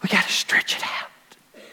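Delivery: slow, soft whispered line; a whispered, slow plea to the church. Acted. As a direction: drop to a hush for the point. Quiet turn in the sermon.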